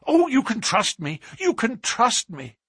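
high, pleading assurance; anxious, servile promise, repeated insistently; cringing eagerness. A narrator's reading. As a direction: beg to be believed. Cowed trainer pleads to be trusted.

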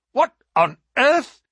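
high, loud startled exclamation; jolted as the light vanishes; sudden surprise. Acted. Signal light suddenly goes out.

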